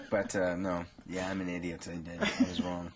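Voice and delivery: normal talking voice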